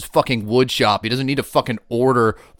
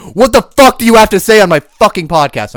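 loud, high, angry shout of "What the fuck do you have to say"; furious yell; 7 semitones higher, 9 dB louder than usual. Pretend. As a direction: scream it at the guest. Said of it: "I'm sorry I yelled in your ears".

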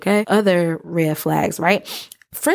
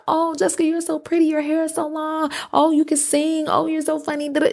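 much higher sweet doting mom voice; friend's gushing mother; 9 semitones higher than usual. Retold or imitated. Friend's mother praising Jessica, high sugary gushing. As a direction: coo it like a doting auntie. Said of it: "she'd be like".

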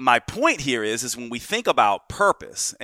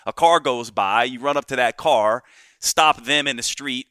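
ordinary delivery

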